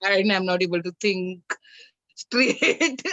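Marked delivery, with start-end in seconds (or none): 2.1-3.1 laughing. Real, not acted. Laughs while telling own frustration.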